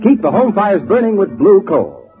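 ordinary talk

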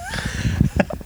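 a quick, breathy burst of laughter; amused laugh. Real, not acted. Guest laughing at own age slip, amused laugh. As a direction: laugh at yourself for getting your own age wrong.

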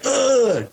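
high mock groan of impact; imitated impact grunt. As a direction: grunt as if slammed onto your back. Imitates impact cracking son's back.

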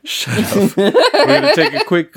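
giggling while talking; laughing. Real, not acted. Laughs at co-host joke.

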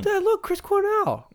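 put-on very high, drawn-out little-kid voice; put-on kiddie squeak; five-year-old boy. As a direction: pipe up like an excited small child calling Dad. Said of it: "He was, like, five".

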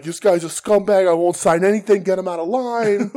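loud, high-pitched imitated tirade; mock angry denunciation. Wrestler throwing a fan out of line, mock angry shouting. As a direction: denounce him to the crowd, hammed-up and loud.

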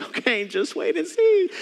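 high, drawn-out skeptical singsong; knowing mock agreement. Husband to wife as a new parent, knowing mock agreement. Acting out his doubting reply to wife.

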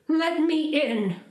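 high, slow call at the door; mother's high call. Acted. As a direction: call through the witch's door. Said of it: "the mother called".

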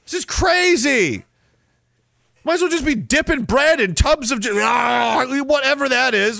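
high and slow, bursting into shouts; exasperated rant. Pretend. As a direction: act scandalised by the food. Ranting against sauces and creams.

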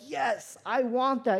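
eager yes. Retold or imitated. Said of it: "and they're like".

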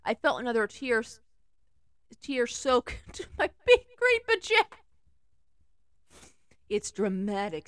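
ordinary delivery

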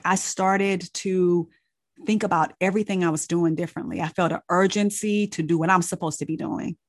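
ordinary delivery

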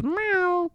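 high, soft imitated meow; cat meow. Imitating the cat pestering him, high mock meow. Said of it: "sits next to her and goes".

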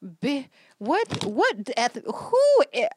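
high, loud and slow, with a laugh; shocked outrage. Real, not acted. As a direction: gasp in disbelief at the nerve. Outraged reaction to Plan B.

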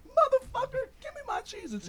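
high-pitched outraged demand; mock indignant. Demanding snacks back from drunk friend, mock indignant. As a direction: act out a squeaky-high protest. Acted quarrel over stolen snacks.

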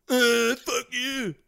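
mock defiant retort. The fool answering the warning, slow sneering retort. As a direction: drawl it back like a stubborn fool. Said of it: "And you're just like".

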